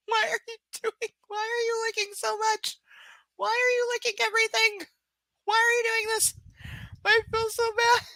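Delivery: very high whiny pet talk. Real, not acted. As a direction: squeal at the licking puppy. Dog licking her face.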